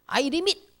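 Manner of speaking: I limit said like ai rimit; playful English command I limit; short light English declaration; Korean-accented English. Shown as a demonstration. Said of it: "뭐라고요".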